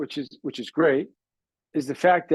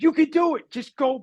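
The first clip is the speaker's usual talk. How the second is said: coach yelling; 9 semitones higher than usual. Retold or imitated.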